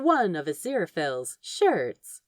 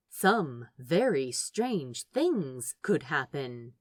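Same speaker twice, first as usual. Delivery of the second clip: usual speaking voice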